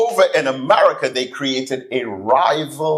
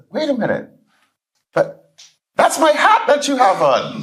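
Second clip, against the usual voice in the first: much higher, rising into an amused protest; 12 semitones higher than usual. Retold or imitated. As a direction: play a flustered man who spots his own hat.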